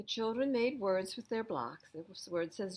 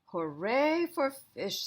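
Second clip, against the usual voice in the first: slow, high, loud cheer; cheering seals; 7 semitones higher, 8 dB louder, much slower than usual. Acted. Seals cheering for their fish, slow high cheer. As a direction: stretch out a happy cheer.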